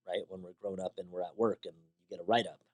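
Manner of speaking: normal talking voice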